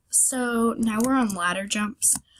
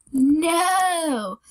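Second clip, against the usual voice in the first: high wailing no; anguished cry; 6 semitones higher, 4 dB louder than usual. Real, not acted. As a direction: cry out in dismay. Fails again, cries out.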